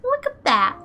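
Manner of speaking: high, loud delighted call; excited. Real, not acted. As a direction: point it out with glee. Delighted at good lighting.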